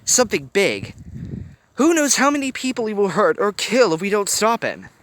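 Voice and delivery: urgent plea. A narrator's reading. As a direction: make her see the danger. Hiro pleading urgently.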